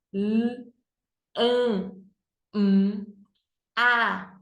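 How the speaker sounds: L, an, um, a as "lll, ã, mmm, a"; letter sounds. Shown as a demonstration. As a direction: sound out each letter with pauses between. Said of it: "fazer o sonzinho das letras". (sonzinho de cada letra: "lll, ã, mmm, a")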